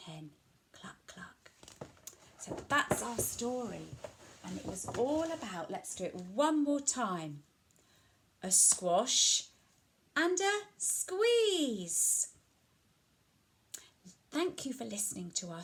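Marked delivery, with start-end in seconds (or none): 0.1-1.5 tiny whispered clucks. Acted. Ending the animal round, whispered hen clucks. 10.2-12.3 high, slow stretched squeeze; slow finale. Acted. Closing the story for children, high slow finale.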